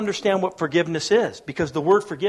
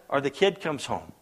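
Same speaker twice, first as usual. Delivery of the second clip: ordinary talk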